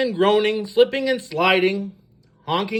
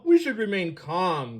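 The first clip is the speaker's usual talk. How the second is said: usual speaking voice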